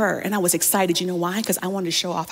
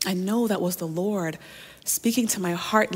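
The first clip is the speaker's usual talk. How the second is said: normal talking voice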